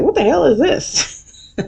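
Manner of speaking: high, slow, baffled; incredulous mock outrage. Everyone reading the church fan invite, high slow disbelief. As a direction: drag out the disbelief. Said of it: "Everyone's like".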